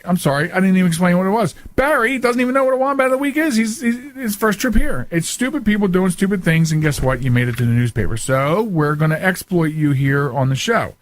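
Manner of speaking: ordinary delivery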